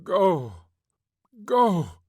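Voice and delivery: high, loud shout building; scream. A narrator's reading. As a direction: scream him away. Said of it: "she screamed".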